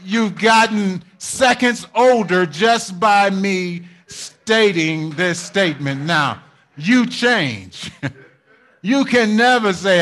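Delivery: loud sermon delivery with chuckles; preaching. Acted. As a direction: drive it home to the congregation. Preaching with rising intensity.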